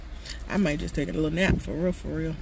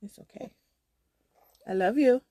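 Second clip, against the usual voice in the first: tender; 6 semitones higher than usual. Real, not acted. Comforting toddler.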